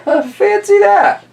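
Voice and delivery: put-on high, slow, amazed old lady; squeaky old lady. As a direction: marvel like a tiny old woman. Old lady marvels in story.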